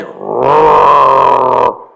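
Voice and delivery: low growling bear roar. Acted. To Bobby Bryna mid-hug, a low bear roar. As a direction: roar like a big friendly bear.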